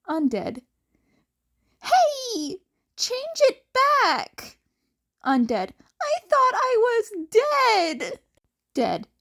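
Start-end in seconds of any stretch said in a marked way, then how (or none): 1.8-4.6 put-on high, slow boyish outcry with a laugh; a high, laughing mock outrage; playful protest. Protesting renamed chat name. 6.0-8.2 put-on high, slow boyish exclaim; a high, playful mock protest. Teasing reply in chat.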